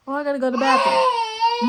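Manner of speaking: put-on high, sing-song kid voice; little girl voice. Cousin fakes needing the bathroom, sweet high kid voice. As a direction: play the sneaky four-year-old asking to go. Mimicking four-year-old cousin sneaking.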